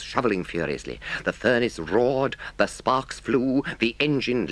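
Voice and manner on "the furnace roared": plain reading voice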